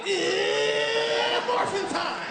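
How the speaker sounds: hammed-up hero transformation call; Power Ranger yell. Comic retells a friend's arrival, mock Power Ranger cry. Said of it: "you yell like a Power Ranger".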